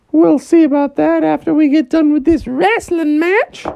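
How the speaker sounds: high, put-on elephant voice. Elephant offering a wrestle, high voice.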